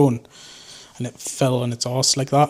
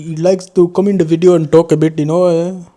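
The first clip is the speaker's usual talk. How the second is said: a light put-on Rajesh voice, higher and louder; 4 semitones higher, 7 dB louder than usual. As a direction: slip into a playful Rajesh voice, raised pitch. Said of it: "Rajesh comes out".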